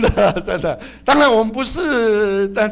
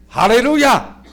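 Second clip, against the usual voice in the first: shouted hallelujah; 5 semitones higher, 7 dB louder than usual. Shown as a demonstration. Christians answering the phone.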